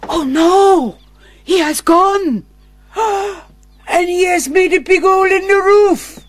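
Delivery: high dismayed cry with a gasp; high alarmed outcry. Acted. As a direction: cry out in shock. Faked alarm at escape.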